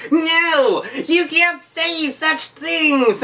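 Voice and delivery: high, slow prissy fanboy voice; mock the offended fan, drawn out and high; mocking a diehard fan. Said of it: "diehard Capcom faithful who are going to be like".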